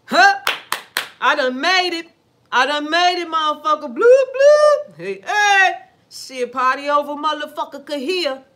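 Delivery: high, loud crowing over having made it; gloating celebration. Retold or imitated. Rising star crows to everyone that they made it, loud and gloating. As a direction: brag loudly about finally making it.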